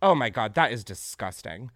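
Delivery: ordinary delivery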